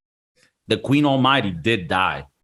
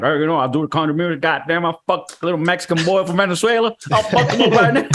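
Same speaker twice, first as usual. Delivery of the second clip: mock Southern accent; 4 semitones higher than usual. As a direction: ham up a big Southern country singer. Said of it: "He's like".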